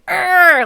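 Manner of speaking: loud, high mock growl of frustration; frustrated growl. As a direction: let out a goofy, frustrated "Arr!". Acting out a frustrated gamer.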